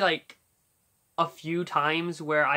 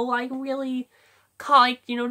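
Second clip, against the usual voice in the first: ordinary talk, nothing marked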